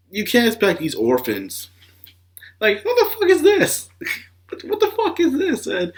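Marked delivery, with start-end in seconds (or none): 2.6-5.9 stunned outburst. Real, not acted. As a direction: pitch leaps way up, disbelieving shout. Shocked reaction to fight scene.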